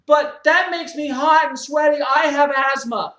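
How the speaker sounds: high, loud self-righteous whine; whiny gym member. Acted. Member defends his mess to the owner, loud high whine. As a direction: complain like a spoiled man-child.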